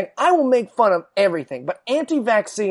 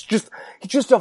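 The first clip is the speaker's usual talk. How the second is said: ordinary talk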